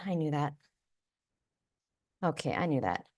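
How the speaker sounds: quiet sheepish admission; sheepish mutter. Real, not acted. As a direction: mumble it to yourself, caught out. Embarrassed over forgotten name.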